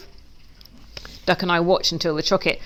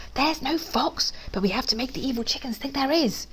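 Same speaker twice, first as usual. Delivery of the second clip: hushed, conspiratorial, raised pitch; whispering; 6 semitones higher, 6 dB quieter than usual. A narrator's reading. Duck letting Pig in on the trick, whispering. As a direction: share the secret softly. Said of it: "Piggy whispers".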